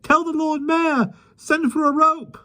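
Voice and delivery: very high, slow panicky cries. A narrator's reading. People in trees cry out, very high panic. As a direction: call out in fright.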